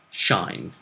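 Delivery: normal talking voice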